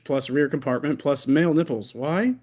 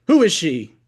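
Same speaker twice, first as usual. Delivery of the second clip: jealous demand; 3 semitones higher, 6 dB louder than usual. Acted.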